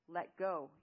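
forceful demand. Acted. Eamon demanding that Zale free her arm, forceful. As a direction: demand it firmly, last warning. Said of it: "Eamon demanded one last time".

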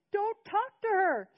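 put-on high, loud screaming boy's voice; a twelve-year-old shrieking in rage at the grandfather; screaming kid, voice cracking with fury; furious child yelling. A narrator's reading. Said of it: "Seth screamed at him".

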